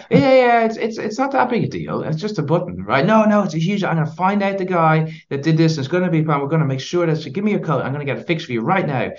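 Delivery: hammed-up flustered lady; calmed customer now playing it down, very high; placated customer. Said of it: "she's like".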